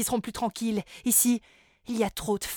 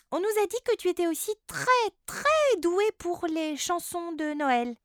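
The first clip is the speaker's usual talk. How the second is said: put-on girl's voice; 7 semitones higher than usual. Girl flatters the singer.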